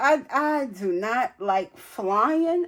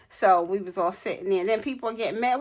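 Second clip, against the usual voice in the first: ordinary delivery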